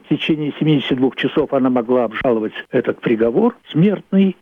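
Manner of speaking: usual speaking voice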